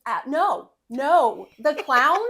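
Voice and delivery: loud, high, imitated outraged no; mock-horrified husband putting a foot down; emphatic refusal. Retelling the husband's answer about the film, loud emphatic refusal.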